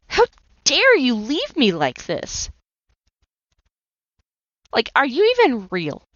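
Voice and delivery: hurt outrage aimed at God; retold furious reproach. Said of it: "I yelled and I screamed".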